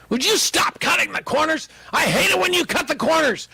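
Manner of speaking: imitating angry wife. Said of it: "she'd get mad at me".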